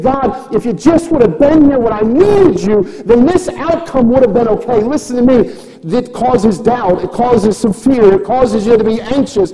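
loud, high sermon delivery; impassioned preaching. Real, not acted. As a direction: preach at full force, driving every point home. Preaches at full force.

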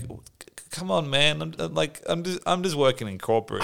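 a put-upon middle manager begging for mercy; whiny office demon. Retold or imitated. Imagining the demon's weary plea.

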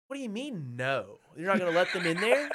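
loud retold protest; indignant challenge. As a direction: argue back loudly in disbelief. Arguing with cops in retold scene.

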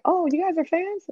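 high-pitched mock-surprised cashier; high, questioning imitation of a cashier; imitated surprise.

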